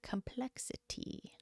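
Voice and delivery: COMPLEXITY in a slow, airy whisper; COMPLEXITY whispered very softly, rising at the end; mock dramatic. Shown as a demonstration. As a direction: whisper COMPLEXITY slowly, mocking your own delivery. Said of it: "I said it like that".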